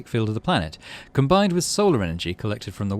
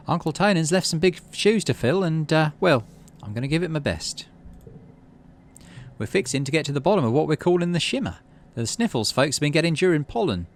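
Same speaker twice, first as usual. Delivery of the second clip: higher, lightly voiced young leader addressing the town; a light young-leader voice, a little hurried; Utopia's speech; 7 semitones higher than usual. Utopia addressing the colony in a lightly voiced higher pitch.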